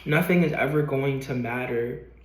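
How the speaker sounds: ordinary talk, nothing marked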